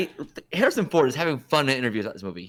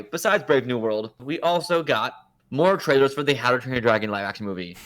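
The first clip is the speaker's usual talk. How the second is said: ordinary delivery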